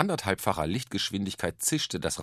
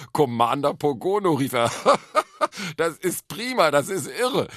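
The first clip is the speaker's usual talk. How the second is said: loud, high words bursting with laughter; wieherndes Lachen; 8 semitones higher, 7 dB louder than usual. A narrator's reading. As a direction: hoot with amusement. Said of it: "Onkel Alfred wieherte vor Vergnügen". (lauthals vor Vergnügen lachend)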